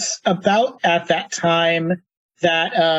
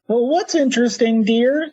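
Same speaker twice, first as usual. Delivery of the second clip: higher-pitched Minnesotan granny; Minnesotan grandmother; 6 semitones higher than usual. Acted. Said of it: "slipping into a Minnesotan accent for grandmothers".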